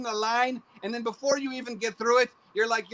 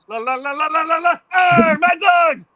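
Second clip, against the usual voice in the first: high, loud sing-song then a slow shout; la-la it like Pee Wee Herman; Pee Wee Herman impression; 6 semitones higher, 11 dB louder than usual. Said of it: "like Pee Wee Herman".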